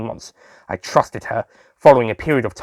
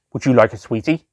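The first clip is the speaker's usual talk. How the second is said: ordinary delivery, nothing marked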